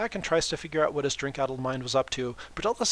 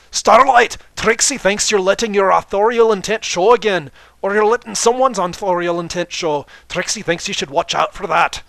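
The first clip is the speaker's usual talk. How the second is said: loud, high, theatrical third-person boast; booming grandstanding showmare voice; boastful showman Trixie; 7 semitones higher, 14 dB louder than usual. Acted. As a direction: proclaim it like a stage magician showing off. Trixie's voice throughout.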